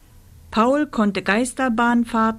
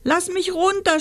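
sobbing; 11 semitones higher than usual. Acted. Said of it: "schluchzte Rosa".

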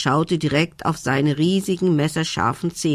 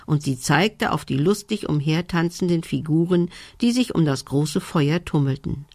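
usual speaking voice

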